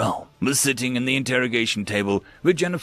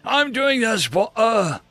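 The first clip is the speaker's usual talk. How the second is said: high, slow, impassioned cry; uncontained outburst; 12 semitones higher, 4 dB louder, slower than usual. Acted. Grice answers Jennifer about his wife, unable to contain himself. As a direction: burst out, stretching each word. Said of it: "unable to contain himself".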